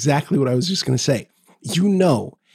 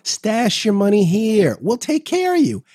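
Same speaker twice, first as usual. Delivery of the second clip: mock tax haven pitch; 7 semitones higher, slower than usual.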